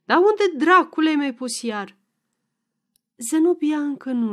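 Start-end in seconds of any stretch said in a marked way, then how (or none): 0.1-2.0 vexed husband snapping at his wife; irritated snapping. A narrator's reading. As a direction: raise pitch and volume in annoyance. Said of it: "se necăji și se răsti la femeie".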